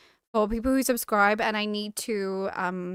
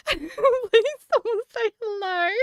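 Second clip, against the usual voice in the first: higher, slow, laughing plea to an empty chat; mock pleading; 10 semitones higher, 3 dB louder, slower than usual. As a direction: drag out a desperate, laughing beg for someone to answer. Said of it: "I was like".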